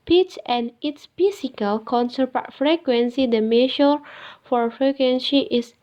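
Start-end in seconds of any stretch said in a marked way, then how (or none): none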